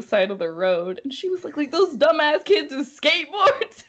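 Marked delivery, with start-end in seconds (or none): 1.6-3.8 high, loud mock-Southern voice; hammed-up Southern accent with a laugh in it; deep Southern accent. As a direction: imitate the Southern friend, amused. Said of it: "in the most deep southern accent".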